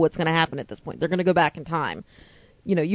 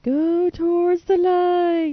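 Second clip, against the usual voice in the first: high, slow, eerie medium call; Poltergeist medium; 12 semitones higher, much slower than usual. Retold or imitated. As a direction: beckon slowly toward the light. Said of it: "Poltergeist medium".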